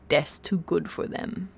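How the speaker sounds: soft, slow, grimly satisfied; grim relish. A narrator's reading. Vogon captain closing his poetry book, quietly grim. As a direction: say it slowly and quietly to yourself. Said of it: "with a grim smile".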